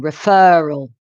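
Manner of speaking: "referral" with the middle syllable stressed, re-FER-ral; stress on second syllable. Shown as a demonstration. Teacher showing similar words apart, "referral" stressed on the second syllable. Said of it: "with a stress on the second syllable".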